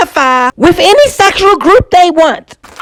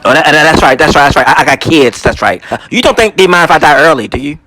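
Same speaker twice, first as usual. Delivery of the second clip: lower muttered aside; 14 semitones lower, faster than usual. Acted. Reacting to doctor.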